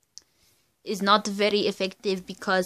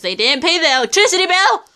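louder, fast, fed-up rant; exasperated fast rant, louder and higher; exasperated outburst; 10 semitones higher, 10 dB louder, faster than usual. Real, not acted. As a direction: blurt the complaint out fast and loud. Complaining the power was cut.

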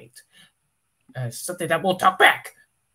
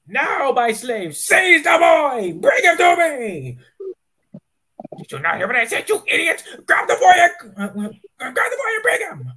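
villain commands; 8 semitones higher, 4 dB louder than usual. Acted. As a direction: roar orders at the slave.

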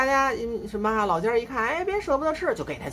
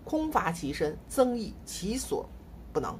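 recited classical quote; 5 dB quieter than usual. Retold or imitated. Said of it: "我说这孩子是吧".

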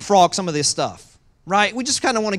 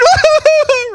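high laugh; 13 dB louder than usual. Real, not acted. Laughing after mock wail.

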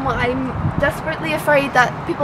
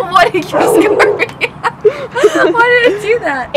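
higher, laughing through the words; can't stop laughing while asking; 10 semitones higher, 4 dB louder, slower than usual. Real, not acted. Laughing after her country bit.

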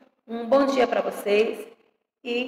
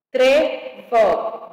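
"Trevo" in two firm beats, tre-vo; syllable by syllable; 4 dB louder than usual. Shown as a demonstration. Teacher separating words for pupils, syllable by syllable. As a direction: split "Trevo" with a gap between syllables. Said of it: "separação de palavras e sílabas".

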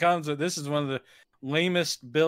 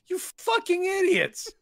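high, slow, laughing scorn; mock-furious muttered insult; exasperated insult; slower than usual. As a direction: sneer it out in disbelief. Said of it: "I was just sitting there going".

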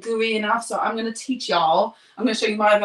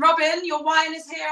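calling out; 6 semitones higher than usual. Real, not acted. Calling out to another room.

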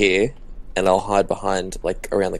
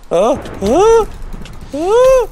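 high, loud yelps of alarm; sudden high-pitched scream; startled screams; 8 dB louder, much slower than usual. Real, not acted. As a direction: shriek as if ambushed. Creeper appears in the game.

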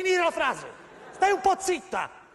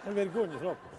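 very soft, bashful murmur; shy mumble; 10 semitones lower, 8 dB quieter, faster than usual. Acted. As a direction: mumble it shyly, barely audible. Acted shy whisper.

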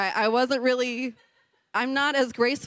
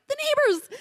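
panicked squeak. Retold or imitated. Panic about noise at 4am.

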